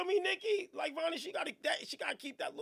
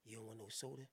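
very low, soft offer; deep lover voice; 11 semitones lower, 14 dB quieter than usual. Pretend. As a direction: croon it low and smooth.

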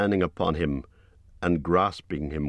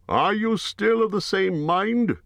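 much higher, slow, formal greeting; Bard the bowman; 4 dB louder than usual. A narrator's reading. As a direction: raise the voice high for a grave herald-like Bard. Narrator voices Bard.

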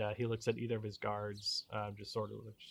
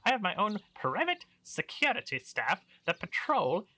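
pompous baron voice; 6 semitones higher, 6 dB louder than usual. Acted. Baron boasting of his security.

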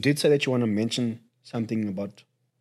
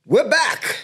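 high, loud show-opener yell; high-pitched hollered comeback; excited shout; 7 dB louder than usual. Acted. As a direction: shout it to announce the return. Calls the show back on.

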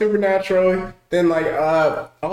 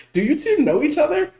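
mock incredulous; 8 semitones higher than usual. Mock-questioning the movie's lovers.

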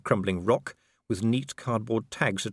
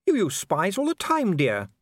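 higher, genteel lady's voice; Lady Sybil; 10 semitones higher, 4 dB louder than usual. A narrator's reading. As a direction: read it as the kindly Lady Sybil. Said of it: "said Sybil".

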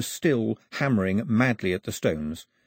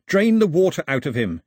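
higher and louder, a shouted order; yelling; 6 semitones higher, 5 dB louder than usual. A narrator's reading. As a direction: yell it across the canoe. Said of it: "he yelled".

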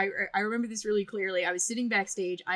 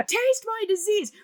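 high, loud mock scream; a high, loud joking scream; screamed line; 13 semitones higher, 6 dB louder than usual. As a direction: yell it gleefully in his face. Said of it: "Right as I screamed".

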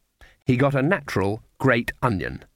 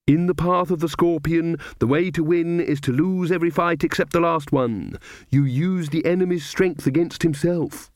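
put-on higher earnest young witch voice; Magrat; 4 semitones higher than usual. Magrat to Nanny, earnestly quoting scorpion wisdom, higher voice. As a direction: recite it like a keen new convert.

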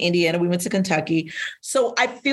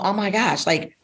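usual speaking voice

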